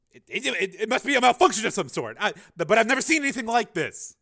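high, loud, tripping excuse; flustered stammering. Acted. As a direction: stumble through it in a panic. Scientist stammers excuse under attack.

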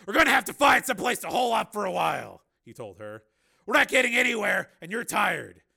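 high, loud, urgent character voice; Steele. Acted. Steele urging his tired companion to find shelter, loud and insistent.

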